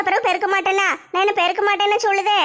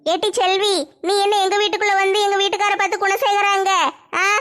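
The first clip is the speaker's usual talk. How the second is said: ordinary talk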